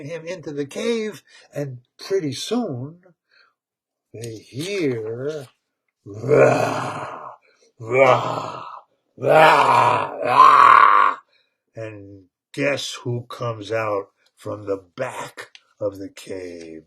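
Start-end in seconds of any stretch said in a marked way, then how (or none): 6.0-11.2 low, growling roar building louder each time. Retold or imitated. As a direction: roar like a beast hidden deep in a cave. Monster roaring from the cave.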